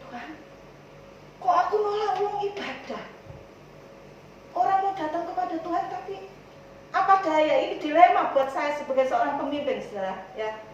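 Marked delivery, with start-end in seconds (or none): 0.1-7.6 higher-pitched, lightly plaintive plea to God; mildly mournful appeal; retold tearful prayer. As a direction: re-enact a pained lament in prayer. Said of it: "Saya menangis".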